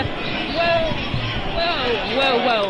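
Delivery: sudden startled exclamations; startled whoa. Real, not acted. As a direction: caught off guard mid-report. Reporter trips over a bike.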